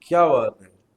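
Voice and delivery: loud, delighted exclamation; amazed praise. Real, not acted. Host marvels that she can say it backwards, loud and delighted. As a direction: cry out in real wonder.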